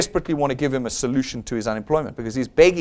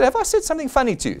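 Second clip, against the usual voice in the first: fast, higher, pointed question; shameless challenge; 8 semitones higher, faster than usual. Retold or imitated. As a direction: throw the question back at them. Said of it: "You know me, I'm shameless".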